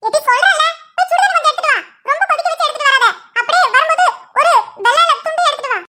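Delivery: high, insistent instructions; bossy ordering. Acted. Grandmother sends a woman for hot water, bossy and high. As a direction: order her about, pitched high.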